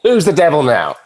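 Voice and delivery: gleeful gloat. Acted. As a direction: rub it in, thrilled.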